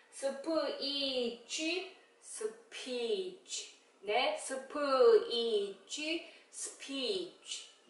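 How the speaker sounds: soft slow phonics for 스피치; slow, soft blending. Shown as a demonstration. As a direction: sound out 스피치 slowly, quietly. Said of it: "blending speech slowly".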